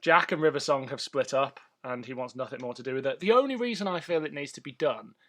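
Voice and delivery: ordinary talk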